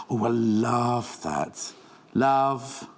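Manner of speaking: very slow, stretched-out "love"; long-held words, swelling on the last; savouring love. Real, not acted. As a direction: linger adoringly on each "love". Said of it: "Can you tell I love this?".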